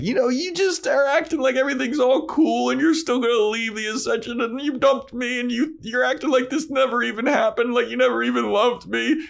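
high, whiny accusation; accusing mock Buffy. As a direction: wail it like a hurt teen girlfriend. Buffy accusing in retold quarrel.

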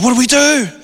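high, frantic plea from a scared man; panicked plea. Retold or imitated. Pastor pleading with the preacher, high and panicky. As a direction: ask it high like you're out of your depth.

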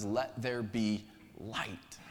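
slow hushed whisper of creation; slow reverent whisper. Acted. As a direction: breathe it out slowly like a secret. Quoting God in Genesis.

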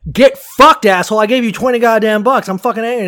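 loud, high-pitched retold outburst; angry blowout. Charter customer blowing up at fish cleaner over a tip, angry. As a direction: re-enact blowing up at someone. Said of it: "had this big blowout".

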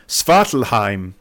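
Svartalfheim given a German pronunciation; German accent. Real, not acted. Host naming the realm in his recap, German accent. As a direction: slip into German for the place name. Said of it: "I stuck a German accent on there".

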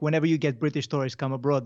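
ordinary delivery

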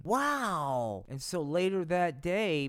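usual speaking voice

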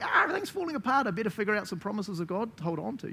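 high, rushed frantic wail; mock panic. A believer in crisis hunting for promises, mock panic. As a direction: squeal it fast like someone scrambling. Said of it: "It's not like".

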